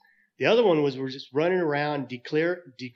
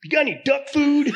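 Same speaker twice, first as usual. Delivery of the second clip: very high, louder duck punchline; 12 semitones higher, 8 dB louder than usual. Acted. Duck's punchline.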